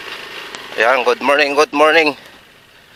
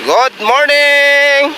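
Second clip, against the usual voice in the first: drawn-out greeting; 6 semitones higher, much slower than usual. Real, not acted.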